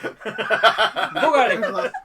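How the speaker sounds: very loud, high, drawn-out mock accent; Saw Gerrera. Player repeating a film quote over laughter, loud hammed-up accent. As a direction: bellow it in an awful put-on accent.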